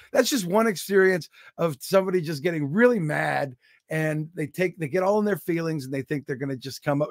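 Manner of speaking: normal talking voice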